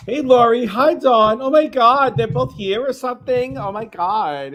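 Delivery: put-on high, loud Valley girl squeal of greeting; Valley girl gush. Greeting Lori and Dawn in a gush.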